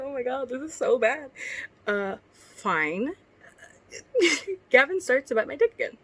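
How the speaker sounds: lightly performed read-aloud of a cringey story; reading fanfic aloud. Acted. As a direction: read it like a story you're embarrassed by. Reads her old fanfic aloud.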